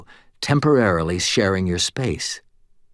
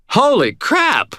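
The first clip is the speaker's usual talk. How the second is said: high, loud, slow startled cry; shouted; 5 dB louder, slower than usual. A narrator's reading. Startled cashier shouting as the bottles shatter. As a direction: yelp it in shock. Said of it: "shouted the cashier".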